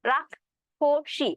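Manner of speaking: রাক্ষসী said slowly with a doubled ক্খ in the middle; slow pronunciation demo. Shown as a demonstration. Teacher showing the middle ক্ষ sound of রাক্ষসী. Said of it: "এরকম আসবে".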